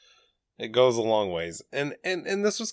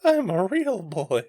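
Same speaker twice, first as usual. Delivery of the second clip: Pinocchio voice; 9 semitones higher, slower than usual. Acted. Pinocchio quote.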